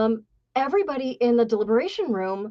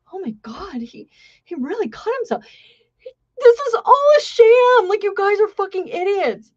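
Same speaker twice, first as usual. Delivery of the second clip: mocking jury; 8 semitones higher, 3 dB louder than usual. Jury scoffing at the injury.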